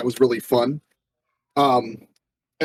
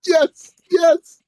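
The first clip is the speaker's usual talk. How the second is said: much higher and louder, cracking with laughter; excited laughing yes; 5 dB louder than usual. Real, not acted. GM delighted by the helium voice, laughing. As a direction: squeal it through a laugh.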